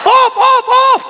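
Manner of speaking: high shouted stop stop stop; Betawi stop call. Acted. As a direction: yell at them to quit, Betawi style. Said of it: "Ini kata orang Betawi".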